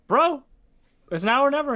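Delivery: high, loud, frantic begging; desperate plea. Retold or imitated. Convict at the gallows begging his lawyer, frantic high plea. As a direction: plead for your life at the last second.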